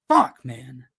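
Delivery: ordinary delivery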